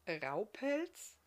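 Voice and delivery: rising, confused question; mildly thrown, pitch rising at the end; puzzled. A narrator's reading. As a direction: repeat the name, bewildered. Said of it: "fragte Barthamiel irritiert".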